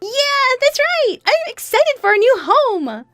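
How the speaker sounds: put-on very high, loud child's cheer; thrilled little son. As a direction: cheer like an excited small boy. Son cheers about new home.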